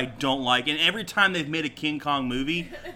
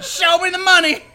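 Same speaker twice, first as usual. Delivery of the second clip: a much higher, louder yell through laughter; laughing mock yell; mock frantic yell; 14 semitones higher, 8 dB louder than usual. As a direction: scream it like a desperate agent. Repeating quote while laughing.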